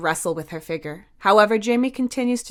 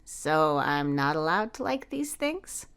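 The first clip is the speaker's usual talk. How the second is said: gentle teasing; 4 dB quieter than usual. A narrator's reading.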